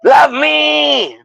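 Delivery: high, shouted melodrama; mock dramatic Peter. Peter demanding love, in a high, hammed-up shout. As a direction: declare it like a pompous hero. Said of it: "And he's like".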